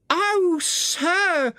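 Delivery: high falsetto washerwoman cry; Toad crying out as washerwoman. A narrator's reading. Toad disguised, begging the engine driver, high falsetto cry. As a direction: Toad wails up high in a woman's voice. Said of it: "cried Toad".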